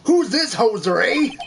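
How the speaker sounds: loud Canadian judge, lifting the "eh". Acted. Judge challenging the lawyer, broad Canadian accent. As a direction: put on a hammed-up Canadian accent. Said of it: "this judge is Canadian".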